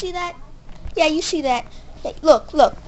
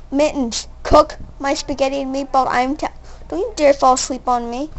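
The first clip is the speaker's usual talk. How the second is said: ordinary talk, nothing marked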